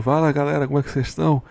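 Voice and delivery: soft, worn-out smoker's voice; tired smoker's voice. Shown as a demonstration. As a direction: croak a greeting like a tired smoker. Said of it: "a minha voz tá mais assim".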